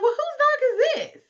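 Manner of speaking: panicked disbelief; startled question. Retold or imitated. Couple asking about the strange dog, high alarmed voice.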